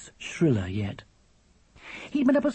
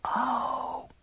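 put-on high, soft, hushed child; whisper; 11 semitones higher, 5 dB quieter than usual. A narrator's reading. Charlie learning of his brother's earache, whispering. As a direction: drop to a small hushed voice. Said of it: "said Charlie in a whisper".

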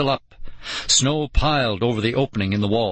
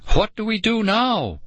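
frightened; 12 semitones higher than usual. A narrator's reading. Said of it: "Nicole choked out".

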